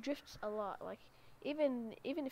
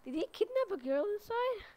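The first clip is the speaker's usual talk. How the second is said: shocked question; 9 semitones higher, 5 dB louder than usual. Real, not acted. Shocked discovery in game.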